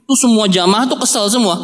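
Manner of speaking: ordinary delivery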